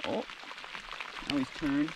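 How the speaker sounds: ordinary talk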